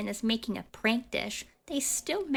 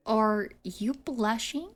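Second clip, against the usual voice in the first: normal talking voice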